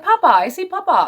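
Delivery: put-on high, sweet toddler voice; baby voice. Imitating the toddler talking to Papa, high and sweet. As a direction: coo it like a small child. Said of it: "He's like".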